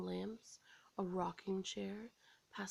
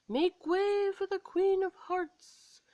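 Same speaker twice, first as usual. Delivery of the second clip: much higher, louder slow proclamation; herald's call; 11 semitones higher, 9 dB louder, slower than usual. A narrator's reading. Herald announcing the Queen of Hearts, loud and slow. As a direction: announce her grandly. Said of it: "a voice called".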